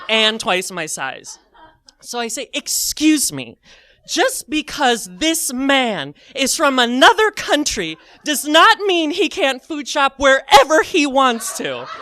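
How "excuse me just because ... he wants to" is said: slow, high, loud indignant speech; righteous challenge. Retold or imitated. Teller standing up to the man for a stranger, imitated righteous rebuke. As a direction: declare it slowly, hammed-up indignation.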